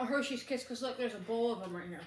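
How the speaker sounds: ordinary talk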